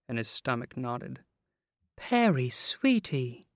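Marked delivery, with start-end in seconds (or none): 2.0-3.5 put-on sweet, high coo to a child. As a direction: coo it tenderly at the boy. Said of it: "she cooed".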